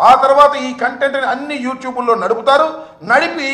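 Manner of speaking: furious denunciation rising in pitch; angry tirade. Real, not acted. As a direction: hammer the accusations home, voice rising. Heated political attack speech.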